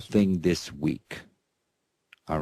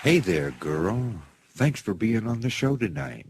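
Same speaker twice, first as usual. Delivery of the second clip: talking to a pet; 4 semitones lower than usual. Real, not acted. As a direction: coo at the dog like a proud owner. Host talks to his dog guest.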